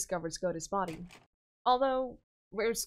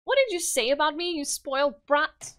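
put-on higher and louder, offended child; indignant boy king; 5 semitones higher, 5 dB louder than usual. Yuri retorting to Pavla, higher louder offended child voice. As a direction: snap back like an insulted little royal.

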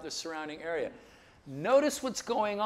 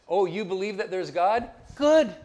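mocking retort; 3 semitones higher, 8 dB louder than usual. Answering people who claim belief, mocking retort. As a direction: feign being impressed, punching the last word.

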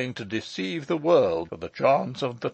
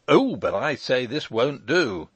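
lawyer's raised, higher protest; crying out; 4 semitones higher, 6 dB louder than usual. A narrator's reading. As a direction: exclaim it in objection. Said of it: "cried the lawyer".

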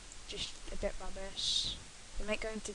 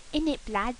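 rough London slang; 4 dB louder than usual. Retold or imitated. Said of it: "speak like a chav".

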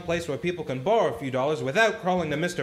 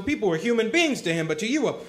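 angry outburst; 6 semitones higher than usual. Acted. George lashing out at Potter at the board meeting, angry shout. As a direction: let the anger climb until it spills over. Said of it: "all Tilly and I heard was a lot of yelling".

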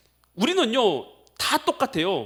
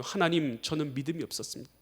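humble confession; 4 semitones lower, 7 dB quieter than usual. Pretend. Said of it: "전 겸손하게 고백합니다".

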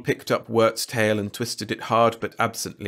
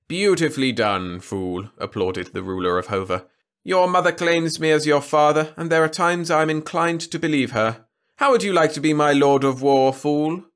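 lordly, lofty king voice; haughty ruler; 7 semitones higher, 5 dB louder than usual. A narrator's reading. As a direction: talk down from the throne. Trescu praising fool.

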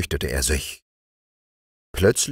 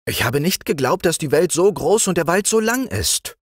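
put-on raised, higher young king; king appointing his friend the frog chamberlain, higher voice; young king's voice; 13 semitones higher, 3 dB louder than usual. A narrator's reading. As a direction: play the young king granting an honour. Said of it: "sagte der König".

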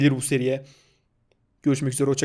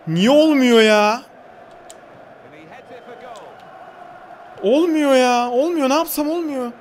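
higher, slow exasperated whining; real exasperated moaning, much higher; frustrated whine; 8 semitones higher, slower than usual. Real, not acted. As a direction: plead with the game in frustration. Failing to score.

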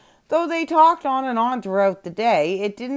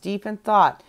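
ordinary delivery, nothing marked

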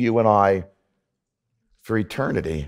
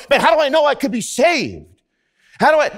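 worried believer; 12 semitones higher, faster than usual. Retold or imitated. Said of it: "a lot of times we go".

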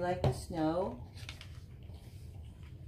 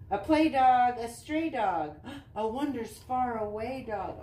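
bouncy sing-song picture-book reading; reading to children; 5 semitones higher, 7 dB louder than usual. Acted. Mom reading a dog book to Easton, sing-song read-aloud. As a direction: read the rhymes with a lilt for little kids.

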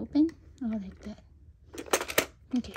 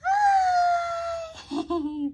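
squealed high excited hi; excited greeting; 4 dB louder than usual. Real, not acted. Greets toddler holding vlog camera.

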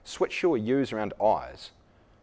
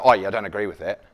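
a louder, curt brush-off; dismissive aggressive reply; 2 semitones lower, 7 dB louder, faster than usual. Shown as a demonstration. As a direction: shut the other person down bluntly. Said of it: "it's aggressive".

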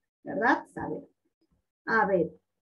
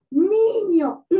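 "niño" with a loud, high, drawn-out first syllable, NI-ño; stress on NI; 6 semitones higher, 6 dB louder than usual. Shown as a demonstration. Teacher showing the tonic syllable, "niño" stressed on NI. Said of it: "entonces la sílaba ni".

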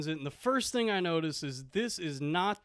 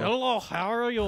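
higher put-on Russian voice; Russian accent; 5 semitones higher than usual. Shown as a demonstration. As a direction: attempt the greeting in a Russian accent. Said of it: "see if I can do a Russian voice".

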